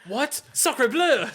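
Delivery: high, loud mock-outraged exclamation; gasp, then burst out high in mock horror; mock shock. Co-host hearing the Italian accents aren't great, mock shock.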